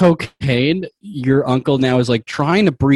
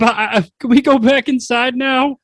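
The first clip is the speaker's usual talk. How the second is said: put-on high, small worried kid voice; a nervous child begging to go inside; timid child voice; 10 semitones higher than usual. Child character nervously asks to leave.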